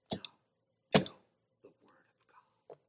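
hushed whisper for weight. Acted. Preacher whispers the answer to the congregation. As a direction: lean in and breathe the words.

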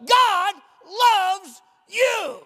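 high, loud and slow, roaring the words out; preaching shout. Real, not acted. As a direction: thunder it to the whole church. Preacher climaxes to congregation.

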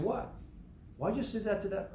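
mock surprise. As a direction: play the puzzled husband, pitch up. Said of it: "I said".

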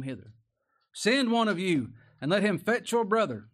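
loud, high preached scripture; full-voiced pulpit reading; preaching at full force. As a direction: proclaim it to the back pews. Preached Joseph's demand.

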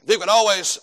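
ordinary delivery

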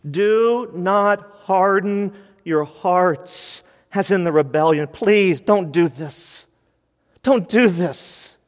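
slow, drawn-out, almost sung pleading; slow, near-sung desperate begging; desperate plea. Real, not acted. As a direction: beg them slowly, heart breaking. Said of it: "with urgency, with love, with desperation".

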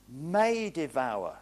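may stretched slowly and leaned on; stress on may. Real, not acted. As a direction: lean hard on may, slow and deliberate. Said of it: "He's putting emphasis on that word may".

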